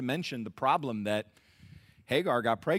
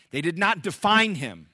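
ordinary talk, nothing marked

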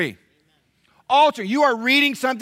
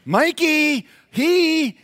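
put-on much higher, louder kid's protest; kid blaming brother; 8 semitones higher, 4 dB louder, much slower than usual. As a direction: jump up high like a kid pointing at his brother. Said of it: "I would look at Nathan and I would say".

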